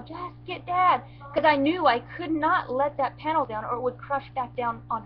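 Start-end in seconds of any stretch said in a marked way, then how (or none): 0.0-1.0 high, slow, insistent; urgent plea. Retold or imitated. Holding the fence panel, begging her daughter to get Dad urgently. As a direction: press every word, desperate for help. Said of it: "I'm all".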